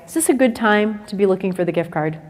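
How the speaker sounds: ordinary talk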